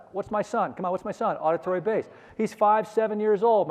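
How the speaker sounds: ordinary talk, nothing marked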